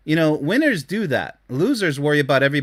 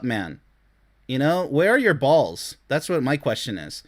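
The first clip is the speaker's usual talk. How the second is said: ordinary delivery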